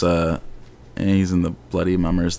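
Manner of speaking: ordinary talk, nothing marked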